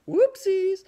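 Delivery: very high, squeaky little oops; cutesy high mock dismay; playful oops. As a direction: sing out a silly high-pitched slip. Playful reaction to a slip.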